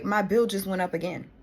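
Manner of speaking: usual speaking voice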